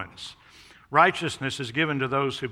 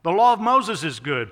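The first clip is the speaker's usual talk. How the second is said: Paul's voice; 3 semitones higher, 8 dB louder than usual. Retold or imitated.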